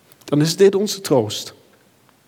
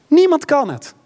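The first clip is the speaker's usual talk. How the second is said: Niemand thrown out much higher and louder; emphatic cry; 11 semitones higher, 3 dB louder than usual. Acted. Preacher insists nobody can snatch them, ringing and loud. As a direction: hammer home "Niemand" as an exclamation. Climax of the sermon.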